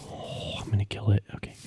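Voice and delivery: hushed whisper, sneaking up on the sheep. Real, not acted. Gamer whispering while stalking a sheep. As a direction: whisper so the sheep won't hear.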